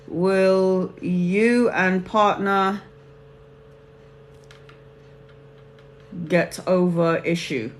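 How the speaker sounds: very slow, drawn-out words with long gaps; slow dictation. Real, not acted. Tarot reader noting a viewer's second question, slowly dictating to self. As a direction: say it haltingly, as if writing it down.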